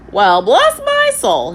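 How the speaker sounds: high, loud, slow parrot squawk. A narrator's reading. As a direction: squawk it like an old sea parrot. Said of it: "he remarked".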